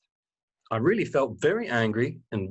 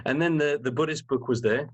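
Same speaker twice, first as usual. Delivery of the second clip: ordinary talk, nothing marked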